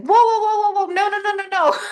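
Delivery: high, loud panicked whoa; jolted awake and refusing; startled protest. Retold or imitated. Being revived in ambulance.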